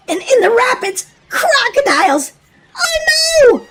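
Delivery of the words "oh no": put-on high frightened child's cry; much higher panicked oh no; alarmed shout. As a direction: yelp at the crocodiles. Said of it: "Willy shouted".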